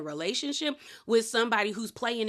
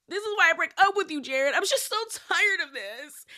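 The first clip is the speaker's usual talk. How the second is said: fake voice-crying; 8 semitones higher than usual. Recapping Brittany's exit to Jared, fake crying. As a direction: force the voice to crack with no tears. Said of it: "voice crying thing where there's no tears".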